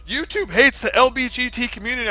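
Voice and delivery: high, loud imitation of online outrage; mock outcry. Streamer mimicking everyone online reacting to YouTube news, mock outcry. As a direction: raise the alarm like an outraged crowd.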